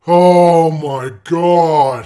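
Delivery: very loud, slow drawn-out groan; stunned outburst. Real, not acted. Gamer stunned at missing the time, loud slow groan. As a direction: let it out long and loud.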